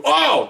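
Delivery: high pained yelp, imitated; high mock groan. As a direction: squeak out a pained oh.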